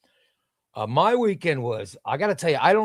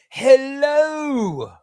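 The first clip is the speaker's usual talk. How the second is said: a high, louder, drawn-out hello; hammy hello; 9 semitones higher, 5 dB louder than usual. Real, not acted. Streamer greeting a chatter with a hammed-up, high, louder hello. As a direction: stretch the hello out big and playful.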